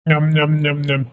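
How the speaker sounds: low, soft nom-nom chewing noises; munch away on a mouthful; pretend munching. Child being fed by mother, contented munching.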